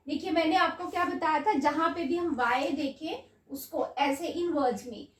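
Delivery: ordinary talk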